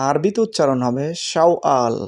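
ordinary talk, nothing marked

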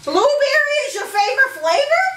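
high, questioning call; loud guess. Real, not acted. Lip-reader in headphones guessing the phrase, high and loud.

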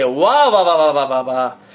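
mock admiration. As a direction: gush in sarcastic applause, sliding down in pitch. Mock applause for their English.

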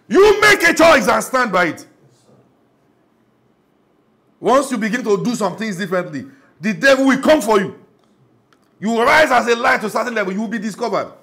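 loud, high fiery sermon; full-force preaching. Acted. Pastor rousing the congregation to stand firm, loud fiery preaching. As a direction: thunder it to the congregation.

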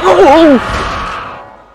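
high loud startled yelp; loud shocked gasp. Real, not acted. Gamer jumpscared at the end. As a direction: jolt at the scare.

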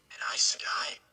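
stunned whisper. Acted. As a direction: breathe it out in disbelief. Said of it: "Rand whispered".

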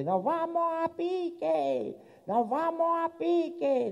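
very high, very slow wailing announcement; captain's alarm. Acted. Captain on deck warns passengers, high and drawn out. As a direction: stretch it out like a panicked captain.

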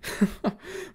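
high breathy burst of laughter; unvoiced laugh. A narrator's reading. Friend finding the request hilarious on the phone, breathy laugh. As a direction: wheeze with laughter. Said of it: "Sam thought this was the funniest thing yet".

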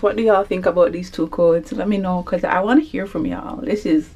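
usual speaking voice

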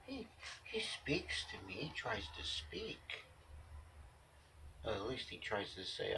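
hushed fond whisper. Real, not acted. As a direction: whisper it softly to yourself. Fond aside about the cat.

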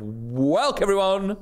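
"Welcome" loses its end, run into "everyone" as "welk-everyone", high and slow; slurred welcome. Shown as a demonstration. Host mimics his own botched greeting, "Welcome" slurred into "welk-everyone". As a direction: stretch out "Welcome everyone" high, swallowing the "-come". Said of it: "I say sort of".

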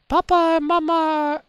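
put-on high child's voice, slow; small child. Child telling a friend about the parents. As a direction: speak like a little kid about to cry. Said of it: "How do I make a bitchy voice, but for a small child?".

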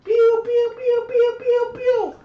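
very high, loud mouth-made laser pew sounds; gunshot noises. Shown as a demonstration. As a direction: fire off cartoon blasters with your mouth. This is what not to do.

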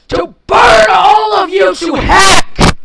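raging scream. Real, not acted. Gamer screaming as daylight burns the mobs. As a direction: scream your revenge at the mobs. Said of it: "leftover rage".

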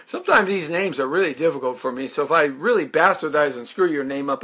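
normal talking voice

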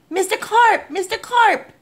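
very high-pitched calling out; yelling. A narrator's reading. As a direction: call after him in alarm. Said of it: "I yelled".

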